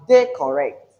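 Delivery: "they correct" with THEY punched, the rest falling; stress on first syllable. Shown as a demonstration. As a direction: hit THEY firmly in "they correct". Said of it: "The emphasis is on the first syllable".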